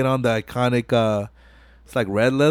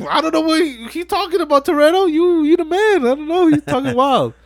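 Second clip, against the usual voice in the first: impressed bystander. Retold or imitated. Crowd member mocking the mechanic.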